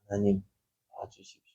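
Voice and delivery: very soft plea fading to near nothing; hushed prayer. Acted. David begging God for help, in a hushed prayer. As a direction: pray under your breath, trailing away.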